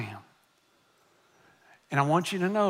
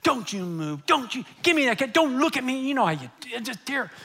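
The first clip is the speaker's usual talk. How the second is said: retold angry yell; 6 semitones higher than usual. Pastor reenacting himself yelling at a little boy, high mock-angry scolding. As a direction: rattle off broken orders as in a reenacted tirade. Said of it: "I just yelled at him".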